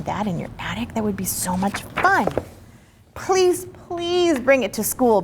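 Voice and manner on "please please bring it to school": high, eager begging. Acted. A friend begs Amy to bring the dragon, eager high pleading. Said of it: "begs Willa".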